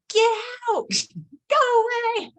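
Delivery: high, crying yell to go away; a little girl screaming at her mother, half in tears; child's yell. Retold or imitated. Angry daughter yelling at mom to leave her room, high and tearful. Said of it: "she just yells at me".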